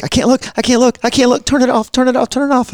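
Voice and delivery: put-on high, frantic child's plea; high-pitched scared kid voice; panicked kid. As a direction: mimic a scared boy begging. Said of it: "he's like".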